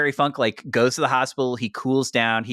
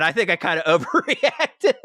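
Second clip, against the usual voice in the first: sheepish laughing admission; 5 semitones higher than usual. Retold or imitated. Terry Funk's sheepish apology.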